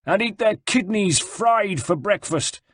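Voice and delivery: high, slow, savouring menace; grumbled threat. A narrator's reading. Prisoner grumbles about his captors to his friend, menacing. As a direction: chew over a gruesome threat.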